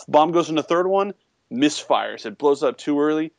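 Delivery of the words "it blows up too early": normal talking voice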